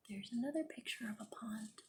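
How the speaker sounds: soft whispered aside. Real, not acted. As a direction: whisper it like a secret while showing the picture. Quiet aside while showing pictures.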